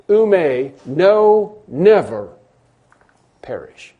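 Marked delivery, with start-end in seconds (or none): 0.1-2.3 "know" drawn out, "never" falling; slow stress on know never. Shown as a demonstration. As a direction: stretch "know never" very slowly. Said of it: "will know never".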